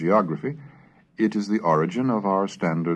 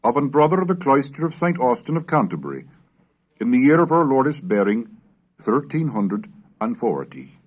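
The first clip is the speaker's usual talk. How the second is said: Middle English recitation; 4 semitones higher than usual. Acted. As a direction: intone it like a medieval scribe reading his Pater Noster. Reads a Middle English prayer aloud.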